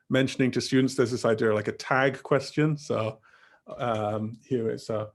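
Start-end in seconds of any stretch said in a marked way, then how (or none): none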